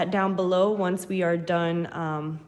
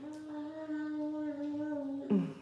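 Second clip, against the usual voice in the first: soft laugh; 7 semitones higher, 9 dB quieter than usual. Real, not acted. Soft laugh after apology.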